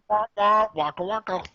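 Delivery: high silly sound effects; goofy radio show noises. Pretend. Wacky morning radio show gag.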